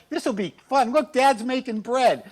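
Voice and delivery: put-on high, eager kid voice; lightly voiced excited kid; cheerful kid. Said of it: "Just like".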